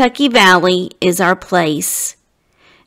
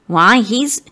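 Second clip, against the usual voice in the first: plain reading voice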